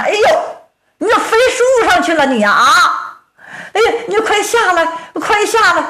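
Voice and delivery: stunned giant begs him to come down; astonished yelp. Acted. Giant amazed he flew into tree.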